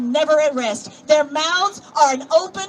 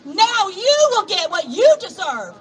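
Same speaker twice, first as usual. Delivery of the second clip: fiery preaching; 6 semitones higher, slower than usual. Acted. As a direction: thunder the prophecy at them, slowly. Preaching scripture to the crowd.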